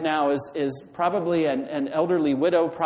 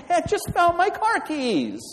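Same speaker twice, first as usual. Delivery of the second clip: very high, gleeful mock yell; excited shout; 3 dB louder than usual. Preacher as the person who found car keys, excited mock shout. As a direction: cry it out as if overjoyed.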